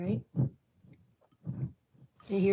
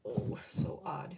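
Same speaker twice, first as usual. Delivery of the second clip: whispered aside; 4 semitones lower, 6 dB quieter, slower than usual. Real, not acted. Reacting to reading.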